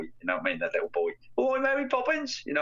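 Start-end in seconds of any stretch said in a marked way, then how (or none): 1.4-2.4 high, slow mock Cockney; Cockney wide boy. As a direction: ham up a Cockney lad in a film. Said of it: "the Cockney wide boy".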